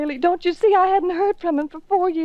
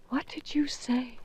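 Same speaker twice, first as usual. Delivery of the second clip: breathless, hushed disbelief; stunned whisper; 3 semitones lower, 11 dB quieter than usual. Acted. Wife whispering to her husband, stunned whisper. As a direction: whisper it, not believing what she heard.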